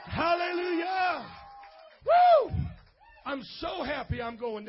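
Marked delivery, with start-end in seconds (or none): 0.1-1.3 long, slow, full-throated hallelujah; shouted hallelujah. Real, not acted. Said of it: "can you shout hallelujah". 2.1-2.5 high, very loud woo of joy; joyful whoop. Real, not acted. Joyful cheer after hallelujah.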